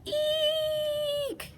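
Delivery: very high squeal; frightened eek. Acted. Carrie squealing in fright at the monster. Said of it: "yelled Carrie".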